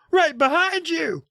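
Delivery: terrified cry. Acted. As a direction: cry out in sudden terror. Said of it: "he cried".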